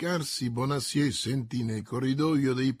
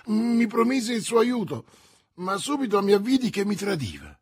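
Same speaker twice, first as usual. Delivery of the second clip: high, loud agitated excuse; agitated defence; 8 semitones higher, 6 dB louder than usual. A narrator's reading. Prisoner excusing himself to the commissioner, agitated. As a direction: justify yourself anxiously, pitched up.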